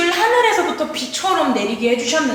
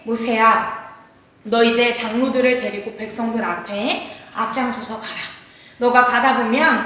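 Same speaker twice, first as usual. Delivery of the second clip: lower, quieter voice of God instructing Moses; 하나님 목소리; 3 semitones lower, 3 dB quieter than usual. Acted. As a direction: speak as God, low and measured. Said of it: "하나님께서 모세에게". (낮고 차분한 하나님의 목소리)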